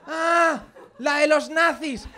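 high-pitched, drawn-out knowing "Ah"; total conviction. Shown as a demonstration. Bluffing about a film to a pedant, feigned conviction. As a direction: bluff it slowly with mock certainty. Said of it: "con toda la convicción que tengas, di".

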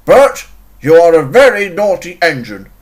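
loud, indignant telling-off; stern scolding. Acted. Controller telling Bert off for rudeness, stern scolding. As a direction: scold a naughty engine firmly.

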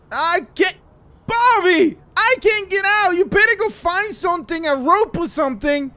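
high, loud panicked yelling; urgent call for help. Acted. Stuck in the water, calls Bobby for rope, urgent. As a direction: holler up to someone for rescue.

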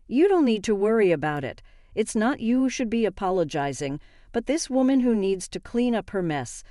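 usual speaking voice

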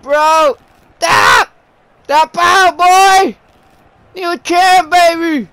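loud high hyped yelling; excited shouting. Real, not acted. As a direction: scream for the tap out. Yelling for submission, celebrating.

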